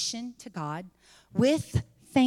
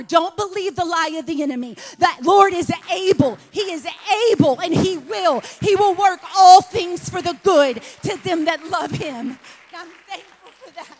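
high, louder preaching build; 7 semitones higher, 7 dB louder than usual. Acted. Preacher assuring the congregation God is able, rising. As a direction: lift the room with it.

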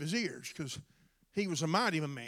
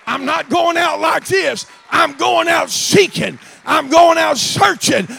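full-force preaching; 7 semitones higher, 19 dB louder than usual. Acted. Preacher shouting to the church not to go out like this. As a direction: hammer each line like a rallying cry.

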